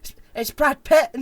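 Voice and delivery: high, loud comic squeal of recognition; mock starstruck excitement. Joking that he recognises Brad Pitt, mock excitement. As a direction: gush like a fan spotting a star.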